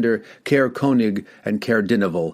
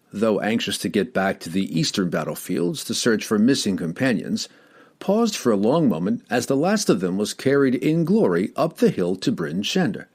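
plain reading voice

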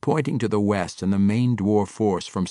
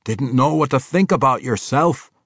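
loud, high dwarf voice; a dwarf king bantering with an old friend; gruff dwarf king; 9 semitones higher, 10 dB louder than usual. Acted. Bruner answering Athrogate, loud and hearty.

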